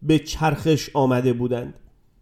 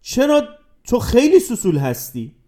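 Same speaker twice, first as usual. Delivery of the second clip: higher, scornful jab leaning on خیلی; girl calling him a sissy, higher mocking tone; 6 semitones higher than usual. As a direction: sneer the insult at him. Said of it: "و با تمسخر گفت".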